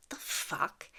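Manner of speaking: breathy whispered the fuck; whispered disbelief. Retold or imitated. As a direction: mutter it under your breath in disbelief. Cop pulls in, siren on.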